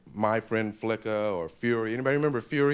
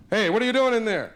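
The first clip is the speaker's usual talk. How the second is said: higher, louder call through a locked door; 8 semitones higher, 4 dB louder than usual. Acted. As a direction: call out to the kid inside. Parent yelling through bathroom door.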